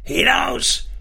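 high-pitched, furious order; furious cry. A narrator's reading. Father driving the children out of the hut, furious cry. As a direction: send them out on a sharp rise in pitch. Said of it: "schrie er in einem Wutanfall".

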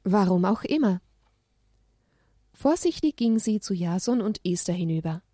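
ordinary delivery

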